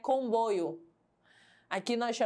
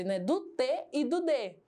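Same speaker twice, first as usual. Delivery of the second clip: ordinary talk, nothing marked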